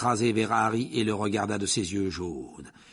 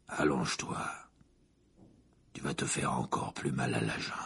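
low, hushed, menacing whisper; soft and much lower, almost voiceless; 10 semitones lower, 7 dB quieter than usual. Acted. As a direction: breathe it low and close like a dangerous man.

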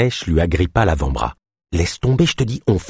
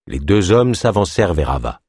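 ordinary delivery, nothing marked

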